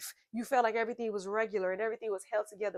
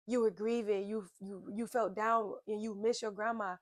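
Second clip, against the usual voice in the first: usual speaking voice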